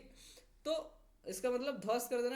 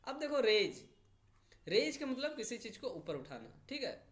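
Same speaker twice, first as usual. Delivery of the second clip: ordinary talk, nothing marked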